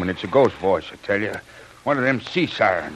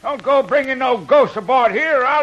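high, agitated call; alarmed calling; 9 semitones higher than usual. Acted. Sailor warning his mate against bringing ghosts aboard, alarmed calling.

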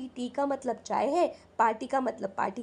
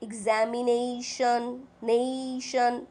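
"Examination" slowed, its ending "nation" drawn out; stress near the end; much slower than usual. Shown as a demonstration. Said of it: "the stress marks on the last syllable".

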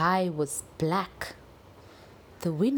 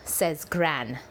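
usual speaking voice

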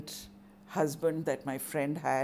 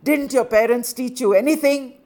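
put-on furious drunk husband screaming, much louder and higher; 8 semitones higher, 14 dB louder than usual. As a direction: scream abuse at his wife.

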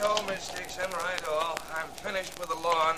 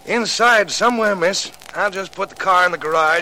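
loud, raised voice calling across the drive; 4 semitones higher, 9 dB louder than usual. Acted. Gardener calling out to Miss Dixon, loud. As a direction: call out to her from the car.

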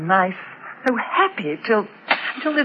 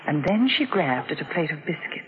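quiet story narration; 6 semitones lower, 3 dB quieter than usual. A narrator's reading. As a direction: softly read inner narration, a little subdued. Mother narrates between scenes.

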